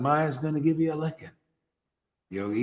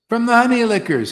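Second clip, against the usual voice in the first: honey punched higher and louder; stress on honey; 7 semitones higher, 6 dB louder, faster than usual. Shown as a demonstration. Preacher pressing the point on honey lickers, stressed. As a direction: hit the word honey hard.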